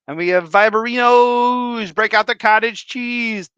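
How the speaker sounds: rally the chat like a game-show host; hype shout. Acted.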